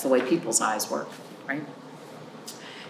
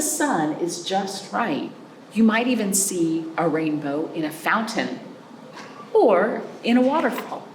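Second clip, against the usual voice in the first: put-on reading to children; 3 semitones higher than usual. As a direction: read it aloud to a class of little ones. Reads a picture book to children.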